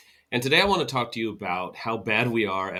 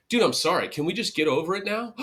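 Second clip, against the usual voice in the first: higher, faster dismissive sorry; impatient brush-off; 7 semitones higher, 3 dB louder, faster than usual. Retold or imitated. Pacifying partner wanting the fight over, impatient and fast. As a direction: hurry past it with a grudging sorry.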